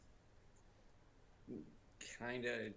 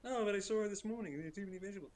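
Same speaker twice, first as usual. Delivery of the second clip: higher, louder mock nag; nagging wife; 10 semitones higher, 4 dB louder, much faster than usual. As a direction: imitate the wife reminding him about dog food. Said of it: "Hey, it's already made".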